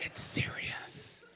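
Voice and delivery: mock whisper, slow and hushed; hushed warning. Comic mocking people who hush every joke, whispering. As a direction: shush the room like it's forbidden. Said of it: "it's like".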